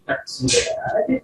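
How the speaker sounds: far from the mic in an echoey room; speaking across an echoing dome. Real, not acted. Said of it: "this dome is really echoey".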